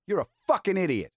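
mock scolding from the annoyed driver; retold angry rebuke. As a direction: snap it back as the furious friend. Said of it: "And he was angry with me".

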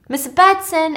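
high, loud searching call. Retold or imitated. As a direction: call out for someone who isn't answering. Virgil calls again, no answer.

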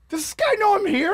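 high, loud voice of an affronted audience member; bewildered kid. Retold or imitated. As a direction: imitate a kid asking around in disbelief.

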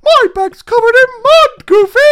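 very high, loud cartoon squeak; Mickey Mouse voice. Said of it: "He's also Mickey Mouse".

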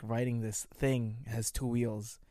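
ordinary delivery

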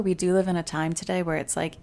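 ordinary delivery

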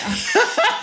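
a short laugh that jumps up high; high emotional laugh. Real, not acted. The guest laughs off tears with the host, a short high laugh.

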